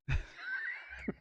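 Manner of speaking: a piercing laugh; very high squeal. Real, not acted.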